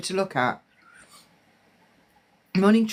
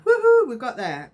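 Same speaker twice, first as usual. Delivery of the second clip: excited cheer; 6 semitones higher, 4 dB louder than usual. Real, not acted. Celebrating a spirit coming through.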